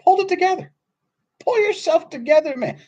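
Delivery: high, loud mock reprimand; exasperated scolding. Speaker telling tearful men off, exasperated scolding. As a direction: order him sharply to get a grip.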